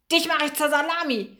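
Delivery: hissed threat. A narrator's reading. Maxe goes after the one who hurt him, hissed threat. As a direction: spit out the threat in fury. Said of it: "zischte Maxe".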